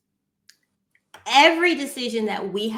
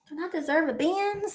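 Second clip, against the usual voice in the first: higher, softer wheedling ask; pleading; 7 semitones higher, 5 dB quieter than usual. Retold or imitated. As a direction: coax him sweetly for a Benz. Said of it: "I look at my husband like".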